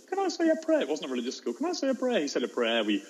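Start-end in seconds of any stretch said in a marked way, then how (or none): 0.1-0.8 put-on very high little-kid voice; squeaky, pleading schoolchild impression; imitated child. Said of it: "one kid said". 1.5-2.2 put-on very high little-kid voice; squeaky, pleading schoolchild impression; imitated child. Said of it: "one kid said".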